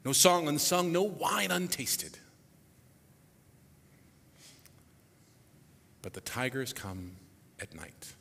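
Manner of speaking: dramatic spoken reading of lyrics; poem recitation. Acted. Recites Fantine's song lyrics.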